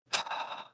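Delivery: long voiceless exhale; sigh. Acted. Man looks up at the one about to end him, sighing. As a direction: let out a resigned sigh. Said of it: "sighs, and he".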